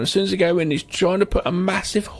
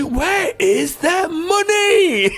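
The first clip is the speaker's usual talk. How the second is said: slow high mock threat; 13 semitones higher, 4 dB louder, much slower than usual. Quoting Kenny's demand.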